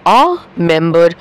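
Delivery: a member, slow, with a clearly marked a; stress on a member. Shown as a demonstration. Teacher pointing students to the article a. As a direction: lean on the article a before member. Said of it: "সেই জন্য".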